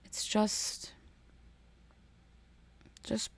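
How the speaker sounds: hushed whisper. Real, not acted. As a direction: breathe it, barely there. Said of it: "whispered aside".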